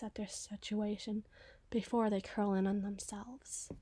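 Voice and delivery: hushed, brooding description of a painful death; dark whisper. Acted. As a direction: murmur it like a ghost story by the fire. Grim whispered musing on burning.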